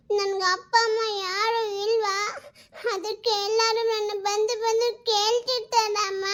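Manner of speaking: put-on tearful child voice, breaking; crying little girl. Daughter cries to her mother that she has no parents. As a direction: sob through the words as a hurt daughter. Daughter fears she's an orphan.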